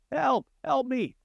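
high, desperate cries for help from a cliff; yelling for help. Retold or imitated. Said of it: "Jim began yelling for help".

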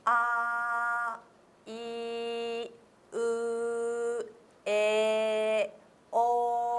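「あ、いう、え、お」を一音ずつ区切って長めに; 先生の見本どおりに母音を並べて; 母音を真似て. Shown as a demonstration. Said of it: "それぐらいの尺でちょっとやっていただいてもいいですか". (母音を区切ってゆっくり発音)

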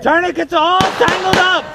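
high, loud, slow shout; shouting over battle. Acted. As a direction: yell the problem out. Frantic report during drill.

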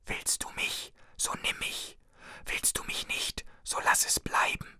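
geisterhaftes, langsames Flüstern ohne Stimme. A narrator's reading. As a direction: wie ein Spuk ins Ohr hauchen. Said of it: "flüsterte eine Stimme". (eine Stimme im Wald flüstert dem Ältesten zu, tonlos geflüstert)

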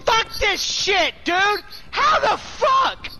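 high furious gamer rage; angry shout. Real, not acted. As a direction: scream it at the screen. Losing again, raging.

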